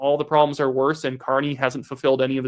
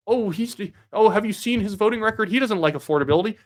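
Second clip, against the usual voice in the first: higher-pitched parody of a critic; mocking mimicry; 7 semitones higher than usual. Host imitating the woman he disagrees with, mocking mimicry. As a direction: parrot her complaint, pitched up.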